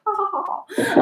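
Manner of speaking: high mock laughter; kids laughing. Teacher imitating her students laughing at the story. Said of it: "they'll be like".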